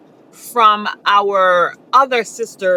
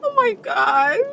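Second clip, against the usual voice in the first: higher, tearful gasp put on for show; fake crying; 4 semitones higher than usual. Shown as a demonstration. Woman showing ladies how to cry at a man, fake crying. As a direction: turn on the waterworks, badly faked.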